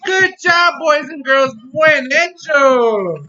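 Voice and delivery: loud, high cheering praise; kindergarten teacher voice. Real, not acted. Praising children loudly.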